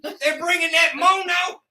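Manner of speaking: hammed-up high holler; mock Texan local. Texan griping about fellas moving in from San Francisco, mock outrage. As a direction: complain loudly as an outraged Texan.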